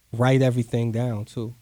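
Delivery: usual speaking voice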